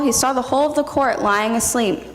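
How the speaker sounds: ordinary talk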